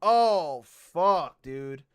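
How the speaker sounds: loud, high "oh no" shock; shocked excitement. Real, not acted. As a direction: blurt it out in stunned disbelief, drawing out the words. Shocked at finding a response.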